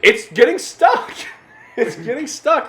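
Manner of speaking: high, slow, laughing insistence. Real, not acted. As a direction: say it through a laugh. Laughing emphatic repeat.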